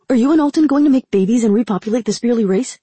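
fast, loud, high teasing. Acted. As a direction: tease her, gleefully fast. Ramsa teasing, voiced figure.

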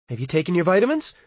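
fussing mom checking up; mother's voice. A narrator's reading. Narrator voices his mother.

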